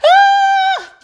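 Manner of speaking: delighted squealing laugh; high burst of laughter. Real, not acted. Laughing at her own morning song. Big laugh after singing.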